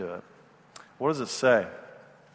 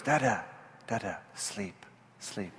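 put-on much softer, slow toddler hush; lulling toddler; 4 semitones lower, 11 dB quieter, slower than usual. Toddler lulls dad on the floor, soft and slow. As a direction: coax dad to sleep softly.

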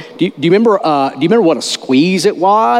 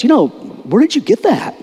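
puzzled; 11 semitones higher than usual. Retold or imitated.

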